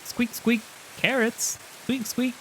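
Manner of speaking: bunny voice. Acted. Game master voicing the bunny, high and squeaky. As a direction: squeak like a tiny rabbit. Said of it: "It, it goes squeak".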